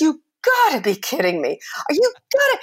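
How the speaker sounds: incredulous dog, rising very high; a hint of an indignant dog; dog's retort. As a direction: voice the dog's disbelief. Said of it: "Robbie looks at me and goes".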